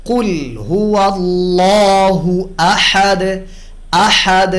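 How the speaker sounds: slow, drawn-out chanted recitation; Quran recitation. Shown as a demonstration. Tajweed teacher reciting the verse as a model: slow Quran recitation. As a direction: recite it slowly and melodically.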